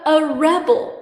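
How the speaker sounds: rebel as a noun, REB-el; stress on first syllable. Shown as a demonstration. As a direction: punch the start of rebel: REB-el. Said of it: "So on the noun, the accent".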